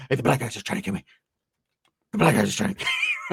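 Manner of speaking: low-pitched mock paranoid muttering; paranoid aside. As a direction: imitate him muttering a suspicion to himself. Voices the man's paranoid aside.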